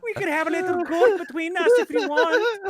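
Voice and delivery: high-pitched stage Irish; hammed-up Irish brogue, way off; playful cartoon Irish taunt; bad Irish accent. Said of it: "you're about a hundred miles off".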